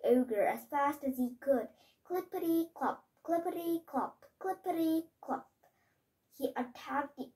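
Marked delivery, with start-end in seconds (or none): 2.0-5.5 higher, bouncy clippity-clop; sing-song hoofbeats, pitched up; clip-clop. Acted. Child reading the knight's gallop as a higher bouncy clippity-clop.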